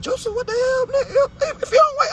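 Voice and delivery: extremely high, squeaky disbelief; incredulous squeal. Retold or imitated. Reader reacting to Joseph believing Mary, incredulous high squeal. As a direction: squeal at him like he's a fool.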